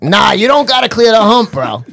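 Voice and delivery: high, loud mock contradiction; arguing park guy. Other guy answers at the jump, arguing back. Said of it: "these guys are arguing".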